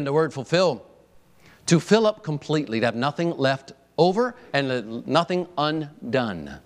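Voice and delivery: usual speaking voice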